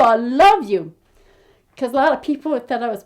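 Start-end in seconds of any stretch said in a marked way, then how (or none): 0.0-0.9 a louder affectionate call-out; mock shout. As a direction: call it out to the crowd. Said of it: "I shout to Kilkenny people".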